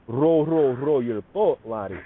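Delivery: a playful Scottish nursery rhyme; Scottish accent. Retold or imitated. Teaching the group, a light Scottish accent singing the rhyme. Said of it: "that Scottish".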